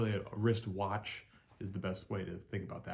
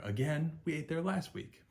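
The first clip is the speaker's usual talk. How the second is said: whiny complaint; 8 semitones higher than usual. Acted.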